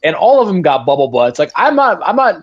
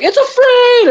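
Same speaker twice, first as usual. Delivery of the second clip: much higher, jubilant repeat of the line; the crowd screams it back in triumph; excited crowd echo; 3 dB louder, slower than usual. Retold or imitated. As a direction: troops cheering the captured bug. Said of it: "they're like".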